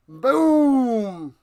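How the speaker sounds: long, drawn-out boom; explosive exclamation. Real, not acted. Punctuates the win.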